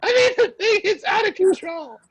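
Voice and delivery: loud, high, laughing while talking; cracking up mid-story. Real, not acted.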